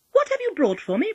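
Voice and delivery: put-on high, loud amused child; secretly amused. A narrator's reading. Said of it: "laughing to herself".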